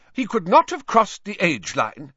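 high, loud, sharp Scottish teacher; stern Scottish professor; McGonagall, sharply. A narrator's reading. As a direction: snap it out with stress on not. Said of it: "said Professor McGonagall sharply".

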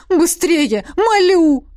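high, loud, desperate urging; exclaimed plea. A narrator's reading. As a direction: cry out, begging them to hurry. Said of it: "воскликнул жрец". (громкая мольба)

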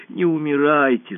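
plead, a touch tearful; tearful plea. A narrator's reading. Alisa to the dying empress, tearful plea. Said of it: "заплакала она".

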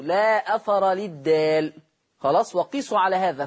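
normal talking voice

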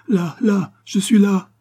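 put-on child running and crying out, frantic. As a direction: shout back to the voice in a panic. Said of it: "s'écria Diane en courant tout affolée".

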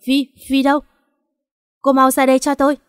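furious yell searching the room; shouting. A narrator's reading. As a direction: shout for her through the house. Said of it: "Nó hét lớn".

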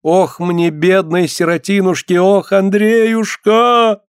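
high, stretched peasant keening; acted grief, pitched high; wailing lament. A narrator's reading. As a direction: wail out the loss in long vowels. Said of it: "заголосила без слов".

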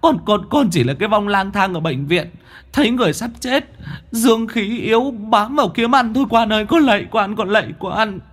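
high wailing spirit cry; a ghost shrieking at the demon guards; high, frightened ghostly wailing; terrified spirit wail. Acted. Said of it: "kêu lên oai oái".

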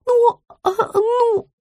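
slow, high-pitched hemming; hesitant mumbling. A narrator's reading. Rita avoids answering her client, hesitant. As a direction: stall nervously in a small high voice.

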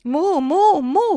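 high, loud cow chorus; echoing moos. A narrator's reading. Other cows echo in the stable, loud mooing. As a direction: moo loudly, way up high. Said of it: "facevano eco le altre mucche".

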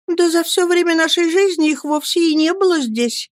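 put-on a shy young widow answering; timid young mother. Mother voiced in story. (высокий робкий голос матушки)

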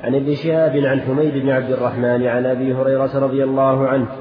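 usual speaking voice

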